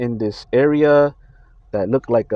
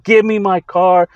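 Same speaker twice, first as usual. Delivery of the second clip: higher, louder, slow mock-angry demand; retold angry demand; 7 semitones higher, 8 dB louder, slower than usual. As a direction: bark it at the brother, reliving the fight. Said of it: "I'm talking to him angry".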